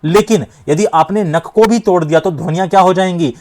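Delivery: normal talking voice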